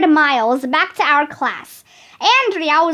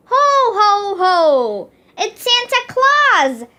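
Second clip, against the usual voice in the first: put-on kids shouting; 5 semitones higher than usual. A narrator's reading. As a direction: cheer it out like a room full of kids. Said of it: "everybody shouted".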